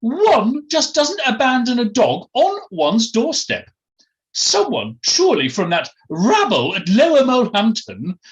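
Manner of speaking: high, loud upper-class disdain; Felicity Forsyth Twike, haughty. Acted. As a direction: look down your nose in a posh, swooping voice. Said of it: "she added haughtily".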